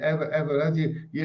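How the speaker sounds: ordinary talk, nothing marked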